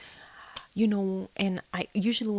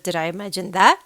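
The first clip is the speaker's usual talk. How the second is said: loud, rising challenge; pointed question; 10 dB louder than usual. Retold or imitated. To husband after the bangs, louder challenge. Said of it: "I said".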